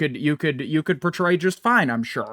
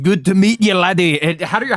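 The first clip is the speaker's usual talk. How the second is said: louder, hammed-up Scottish brogue; bad Scottish accent; 2 semitones higher, 3 dB louder than usual. Birthday-party Spider-Man greets a kid in shaky Scottish. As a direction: attempt a Scottish accent, badly. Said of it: "I can't do Scottish".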